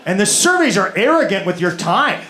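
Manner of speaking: usual speaking voice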